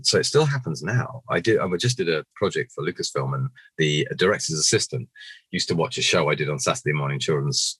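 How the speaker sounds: usual speaking voice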